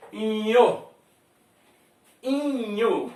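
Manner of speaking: "inho" drawn out, nasal nh shown; inho ending. Shown as a demonstration. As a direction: stress the ending "inho" clearly. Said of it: "Aqui a gente fala inho".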